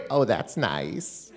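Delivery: put-on suddenly settled, slow old-lady voice; grandma's mild reply. Grandma finally hearing grandson, slow placid old-lady reply. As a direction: drop to an unbothered, drawn-out grandma voice. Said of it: "this is how she talked".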